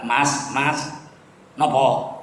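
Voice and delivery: soft, low Javanese accent; polite Javanese villager asking, in a Javanese lilt. Shown as a demonstration. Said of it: "Itu mesti logat Jawa".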